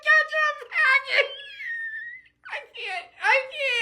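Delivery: very high squeal through real laughter; laughing uncontrollably. Real, not acted. Telling friends about a ketchup packet nickname, cracking up. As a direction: gasp the words out, helpless with laughter.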